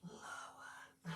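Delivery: very soft, voiceless whisper; hushed yoga-teacher whisper. Real, not acted. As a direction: whisper the cue under your breath. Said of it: "I don't know why I'm whispering".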